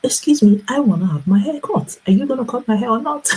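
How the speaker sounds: high, pushy, fast mock indignation; indignant retort. She insists the barber cut her hair, high indignant demand. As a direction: demand it firmly, a little exasperated. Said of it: "I'm like".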